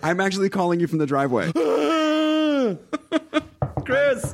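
high, laughing, playful phone voice; giggly late night call. Pretend. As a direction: giggle through a creepy lover bit. Stalker-lover bit, laughing.

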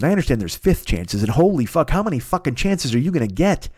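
ordinary talk, nothing marked

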